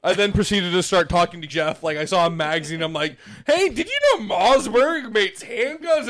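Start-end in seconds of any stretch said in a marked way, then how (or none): 3.5-6.1 high, drawn-out excited question; mock eager chatter. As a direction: act out blurting a fun fact to a friend. Acts out eagerly chatting to Jeff.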